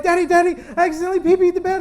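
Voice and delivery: put-on high, loud child voice, rushing; little boy confessing. Son to dad after an accident, urgent confession. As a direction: blurt it out like an upset kid. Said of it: "my son would have a little accident".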